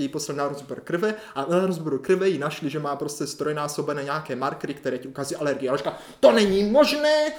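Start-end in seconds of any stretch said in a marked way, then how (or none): 6.2-7.3 high, slow, loud disbelief; retold scolding shout. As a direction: yell it drawn out, refusing to believe. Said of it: "tam pomalu seřvala". (protáhlý pohoršený křik doktorky)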